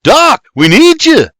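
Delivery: high, loud urgent plea; shout. A narrator's reading. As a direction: shout to rouse the doctor. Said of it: "William shouts".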